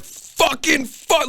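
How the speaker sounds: rapper friend screaming into the mic, high-pitched mock scream; drawn-out, high-pitched imitation of screamed rap; retold screaming. Recording horrorcore rap in a bedroom, mock screaming. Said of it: "screaming for some reason".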